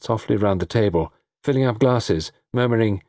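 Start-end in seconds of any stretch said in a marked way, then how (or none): none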